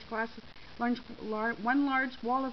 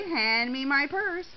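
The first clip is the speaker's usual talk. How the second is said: slow, louder smug command; 5 semitones higher, 6 dB louder, much slower than usual. A narrator's reading. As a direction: order it with drawn-out satisfaction. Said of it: "Shirley said smugly".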